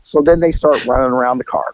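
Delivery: ordinary talk, nothing marked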